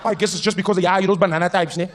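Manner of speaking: put-on high, loud Cape accent; taunting Cape guy. Local explains banana types to the visitor, taunting Cape accent. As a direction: needle him loudly in a Cape accent.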